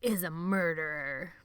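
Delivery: slow, relishing vampire menace; savoring like a vampire. A narrator's reading. Benny revealing the secret, slow vampire relish. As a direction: linger on each word like a vampire. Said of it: "savoring every bloody word like a vampire".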